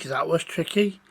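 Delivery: usual speaking voice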